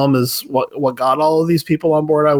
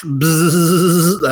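buzz sound. Retold or imitated. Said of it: "I'll wave it here over my watch".

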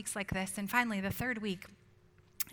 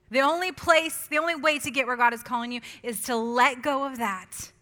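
loud, driving sermon voice; preaching at full force; 3 semitones higher, 10 dB louder than usual. Acted. Preacher urging the congregation to surrender, loud and driving. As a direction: push the point home to the congregation.

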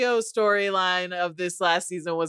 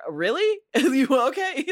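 mock baffled; 7 semitones higher than usual. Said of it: "he was just like".

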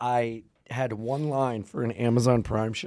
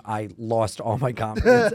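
normal talking voice

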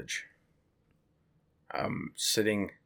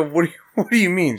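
higher, louder bewildered question; confused reply; 8 semitones higher, 8 dB louder, faster than usual. Retold or imitated. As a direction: play it baffled. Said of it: "I go".

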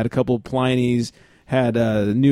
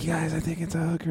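hushed, much softer aside; confiding aside; 2 semitones higher, 10 dB quieter than usual. Retold or imitated. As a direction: slip it to your buddies under your voice. Retold secret aside to his friends.

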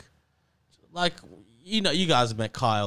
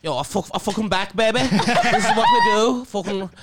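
higher, louder Scouse rapper swagger; Kyle Legacy impression; 7 semitones higher, 5 dB louder than usual. Impression of their mate Kyle for the podcast hosts, cocky and loud. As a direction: brag it like a cocky Liverpool lad. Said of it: "Will you please do".

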